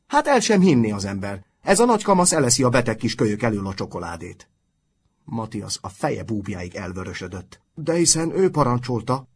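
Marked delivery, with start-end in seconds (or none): 0.1-4.5 loud, outraged scolding; indignant shouting. A narrator's reading. Nurse catching the visitor eating the patient's chocolate, loud and indignant. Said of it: "kiáltott". 7.8-9.3 high, loud protest through a mouthful; chewing while answering. A narrator's reading. Boy defending himself to the nurse, protesting mid-chew. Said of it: "felelte rágás közben".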